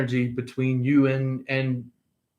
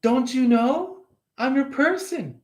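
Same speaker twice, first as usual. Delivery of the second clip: much higher, sugary and insistent; mock sweet claim; 11 semitones higher than usual. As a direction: coo it up high like a lovesick stranger. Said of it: "they're like".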